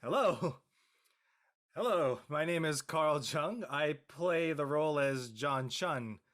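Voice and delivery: ordinary delivery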